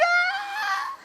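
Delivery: high-pitched drawn-out "aah"; mock fear cry. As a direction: let out a long, high mock wail of fear. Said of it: "It sounds like".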